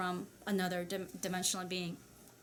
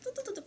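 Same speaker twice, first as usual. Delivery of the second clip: mock typing hum; 13 semitones higher, 7 dB quieter, much faster than usual. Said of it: "and I'm just".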